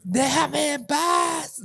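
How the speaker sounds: put-on very high, drawn-out kid voice; slow, squeaky child impression; kid from a video. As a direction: imitate a little kid, stretching each word. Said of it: "where that kid's like".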